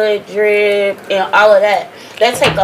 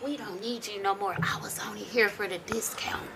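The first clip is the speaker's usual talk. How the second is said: whispering; 16 dB quieter than usual. Retold or imitated. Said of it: "She was like".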